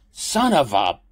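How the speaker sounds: loud, high, cut-off curse; pained curse. Acted. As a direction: swear through the pain. Toe smashed, cursing.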